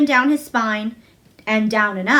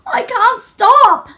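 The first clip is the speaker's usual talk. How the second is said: much higher, loud, slow sobbing gasp; gasping sob; 12 semitones higher, 7 dB louder, much slower than usual. A narrator's reading. Colin sobbing to Mary that he can't stop, high and gasping. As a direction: gasp it out through tears. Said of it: "he gasped and sobbed".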